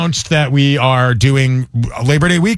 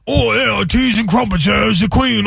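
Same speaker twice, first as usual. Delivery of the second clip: mock British accent; 5 semitones higher than usual. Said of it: "They're like".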